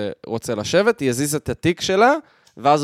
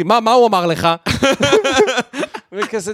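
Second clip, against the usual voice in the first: laughing; 8 semitones higher, 4 dB louder than usual. Real, not acted. Laughing while retelling the scene.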